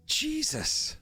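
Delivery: exasperated. Real, not acted. Shocked by rules text.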